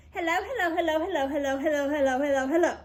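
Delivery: rapid string of teasing hellos; as fast as possible. A narrator's reading. Lori pesters Jeff with rapid hellos. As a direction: rattle them off as fast as you can. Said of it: "said Lori as fast as she could".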